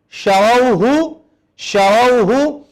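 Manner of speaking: شروح stretched very slowly with a full ش; very slow drill. Shown as a demonstration. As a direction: pull شروح out syllable by syllable. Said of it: "بڑے شین سے ہیں".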